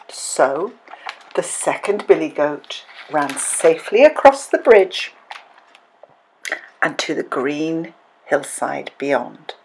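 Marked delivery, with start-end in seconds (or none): none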